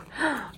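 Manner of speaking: sharp high gasp; gasp of delight. Real, not acted. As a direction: catch your breath in delight. Émue devant les marque-pages. (petit souffle ému)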